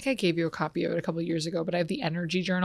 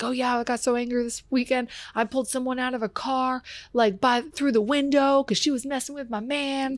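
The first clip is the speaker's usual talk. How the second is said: lightly voiced teen, pitched up; imitating teen storyteller; 5 semitones higher than usual. Coach imitating a girl recounting a weekend fight, higher-pitched. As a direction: retell it as a kid casually owning up. Retold youth's confession story.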